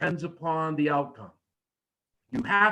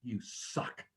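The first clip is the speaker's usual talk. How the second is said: hushed taunt; 8 semitones lower, 13 dB quieter than usual. Shown as a demonstration. Quiet taunt inside promo demo.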